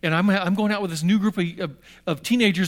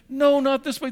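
alarmed protest; 8 semitones higher, 4 dB louder than usual. Retold or imitated. Boy protests taxi going wrong.

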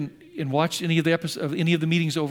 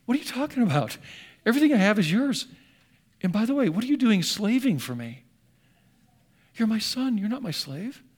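higher-pitched, incredulous dad; baffled father; 5 semitones higher than usual. Acted. Dad answers resentful son about slaving, baffled protest. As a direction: protest in loving disbelief, voice raised in pitch. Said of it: "And Dad's going".

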